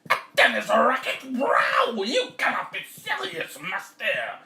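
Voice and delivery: high, loud squawk of disbelief; outraged cat. Acted. Cat hears of tennis racket fate, high outraged cry. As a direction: protest as a horrified cartoon cat. Cat answers farmer in horror.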